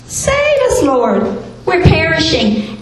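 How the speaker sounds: retold cry for help, pitched high; high-pitched imitation of a desperate appeal; mild desperate plea. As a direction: plead for rescue, lightly acted. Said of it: "they came to him in desperation".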